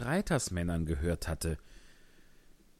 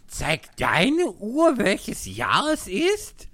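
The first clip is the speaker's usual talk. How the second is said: much higher, loud and very slow; excited March Hare; 12 semitones higher, 8 dB louder, much slower than usual. A narrator's reading. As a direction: hammer the question home, high.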